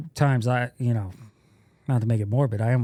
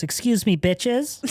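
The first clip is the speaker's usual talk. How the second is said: sassy put-down; 8 semitones higher, slower than usual. Retold or imitated. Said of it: "looks at the two girls and goes".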